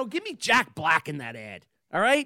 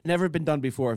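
usual speaking voice

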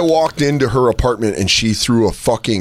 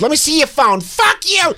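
mock shriek. Imitating angry girlfriend.

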